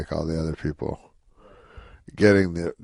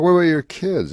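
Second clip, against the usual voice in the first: higher, louder interviewer prompt; eager straight man; 11 semitones higher, 7 dB louder than usual. Retold or imitated. Feeding Rodney a setup line, higher and louder. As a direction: push the question up brightly to set up the joke. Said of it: "and then I go".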